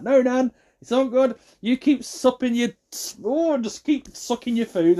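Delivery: high, strained excuse; mock placating. Excusing self from Nan's dinner, mock placating. Retold excuse to leave Nan.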